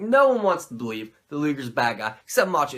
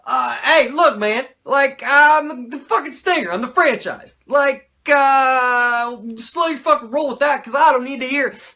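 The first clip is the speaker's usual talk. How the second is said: louder, higher brash surfer-dude Sting; mock Sting; 7 semitones higher, 7 dB louder than usual. Sting brushing off Macho, loud and cocky. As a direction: puff it up like a cocky star. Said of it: "Stinger's like".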